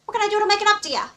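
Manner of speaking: hammed-up frantic apology; frantic mock pleading. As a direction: gush out the apology in one breath. Pleading with an in-game character.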